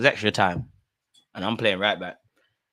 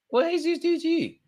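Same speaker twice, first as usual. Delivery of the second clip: very high squeaky falsetto; mock squeal. Real, not acted. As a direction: squeal in fake outrage.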